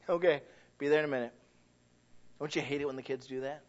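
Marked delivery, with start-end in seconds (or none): 0.1-1.3 quick, lower dismissive answer; mock brushed-off reply. Child answers the parent, quick and dismissive. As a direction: toss it off fast like a stalling kid.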